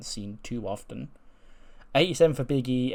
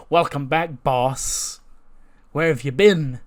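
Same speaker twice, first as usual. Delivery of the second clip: mocking loud greeting; 4 semitones higher, 8 dB louder, slower than usual. Bronson greets Batista mockingly.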